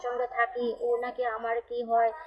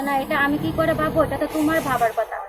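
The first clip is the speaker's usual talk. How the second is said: louder, indignant mock retort; angry retort; 3 semitones higher, 7 dB louder than usual. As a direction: fire back indignantly, raising the voice. Friend's angry retort retold.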